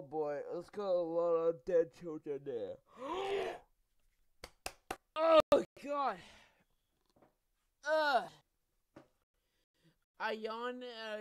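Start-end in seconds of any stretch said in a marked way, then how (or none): 2.8-3.6 high, startled gasp; sharp gasp. Real, not acted. Gamer seeing dead children in game, shocked gasp. 5.1-8.2 high, loud horrified "oh god"; shocked. Real, not acted. Gamer reacting to dead children, horrified exclamation.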